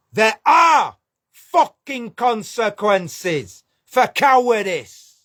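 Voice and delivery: slow, shouted warning, every word hammered; angry shout. Real, not acted. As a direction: bark it out slowly in real anger. Angry rant at cowards.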